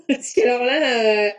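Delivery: amused, laughing through the words; laughing while teasing. Real, not acted. Juror teases a student about their accent, laughing. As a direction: tease them, laughter breaking in.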